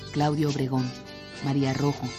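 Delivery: ordinary talk, nothing marked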